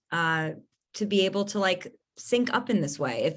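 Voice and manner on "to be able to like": ordinary talk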